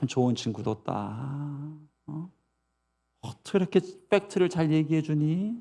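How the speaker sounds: imitating a tactless parent; mock agreeing mother. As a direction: quietly concede it, drawing out the words. Voices an unsupportive mother agreeing.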